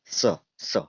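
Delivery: स spoken crisply with tongue at the palate; sounding out स. Shown as a demonstration. Teacher modelling the स sound for students to copy. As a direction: repeat स cleanly, tongue against the roof. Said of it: "जीभ को लगा कर बोलिए स".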